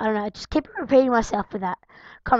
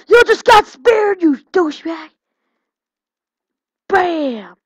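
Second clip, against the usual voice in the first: much higher, louder put-on taunt; mock taunting shout; 7 semitones higher, 7 dB louder, slower than usual. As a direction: jeer at the beaten knight. Taunting game opponent.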